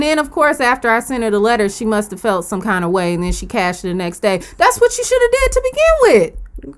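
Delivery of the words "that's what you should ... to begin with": much higher, sassy scold; mock scolding. Telling the woman who cashed late, in a high sassy scold. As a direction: scold her in a high put-on voice.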